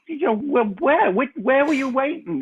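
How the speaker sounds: high, disbelieving question; high incredulous question; incredulous where. Retold or imitated. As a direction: pitch up in disbelief. Asking Lenny where he waited.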